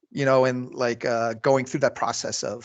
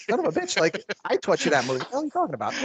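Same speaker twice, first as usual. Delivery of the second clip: mock outrage; 9 semitones higher, faster than usual. Inner outrage at Matt.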